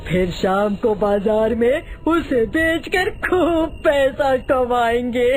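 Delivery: greedy villager relishing the plan; put-on fisherman voice, pleased with the scheme; gleeful scheming villager. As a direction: draw out the last words slowly with relish.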